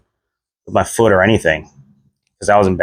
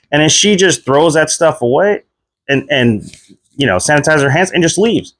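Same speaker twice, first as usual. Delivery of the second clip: normal talking voice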